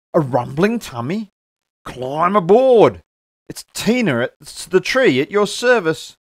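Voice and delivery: the eager tree at your service; cheerful offer. Acted. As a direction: call her aboard with gusto. Tina offers a ride.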